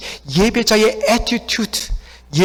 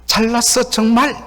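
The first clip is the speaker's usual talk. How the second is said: higher-pitched sarcastic praise; sarcastic compliment, pitched up; mocking nuance; 5 semitones higher than usual. Shown as a demonstration. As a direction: say 잘났어 as a pointed jeer. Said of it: "그런데 뉘앙스가 있잖아요".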